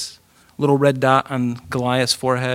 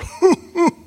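high scornful giant's laugh; mocking giant laugh; 14 semitones higher than usual. Goliath mocking David with a high, scornful laugh. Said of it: "this guy's going".

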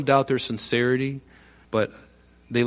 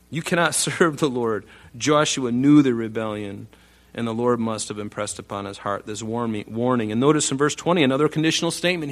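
usual speaking voice

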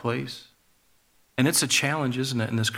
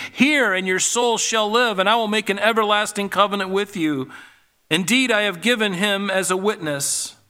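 higher, urgent pleading scripture read; God pleading; 5 semitones higher than usual. Acted. Preacher reads God calling people to come, pleading and raised. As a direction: plead with the listener through the verse. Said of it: "This is God pleading".

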